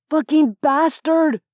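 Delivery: high, loud furious roar. A narrator's reading. Richard roaring at Ivan after the shot, loud and furious. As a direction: curse him with all you have. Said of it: "Richard roared".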